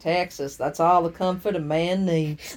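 slow low Texan cowboy drawl; lightly put-on Texas accent. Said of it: "I can't think how to do a Texas accent".

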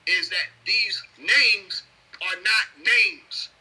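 very slow, deliberate statement; slow emphasis. Real, not acted. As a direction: lay out each word slowly. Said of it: "write it down".

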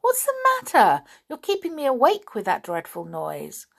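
high, sharp tiny fairy voice; pixie. A narrator's reading. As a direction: scold like a woken little sprite. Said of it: "said the pixie".

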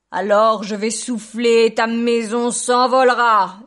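very loud, slow, booming threat; angry wolf howl. A narrator's reading. Wolf threatening the brick house, howling angrily. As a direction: howl it out, stretching every word. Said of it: "hurla le loup en colère".

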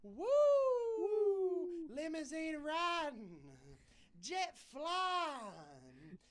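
high, drawn-out wrestling promo yell with "woo"; Ric Flair voice. Retold or imitated. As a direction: hype it up like a strutting wrestling champ. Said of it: "I believe in Hollywood Hogan and Ric Flair".

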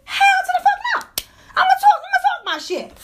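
defiant shout. Real, not acted. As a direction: yell it out, laughing, not caring. Defiant rant against critics.

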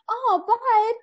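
high, tender coo; touched mom. Retold or imitated. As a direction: coo it like a mom melting at her child. Touched mom answering her emotional son.

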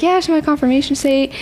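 excited. Retold or imitated. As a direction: proudly share the news. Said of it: "she's like".

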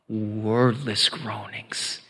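slow, low near-whisper; hushed. Shown as a demonstration. Preacher answering "through what?", slow and hushed. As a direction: stretch it out softly, almost all breath.

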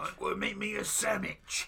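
hillbilly shout. Pretend. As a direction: shout it like an angry farmer. Mocking hillbilly sandwich talk.

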